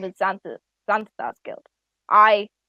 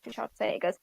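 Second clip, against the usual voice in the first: ordinary talk, nothing marked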